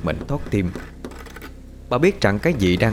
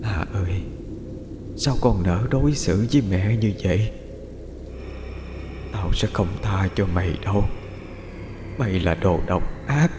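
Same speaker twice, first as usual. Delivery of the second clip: ghostly whispered call; 4 dB quieter than usual. A narrator's reading. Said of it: "miệng gọi thì thào".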